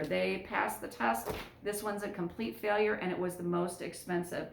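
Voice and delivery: normal talking voice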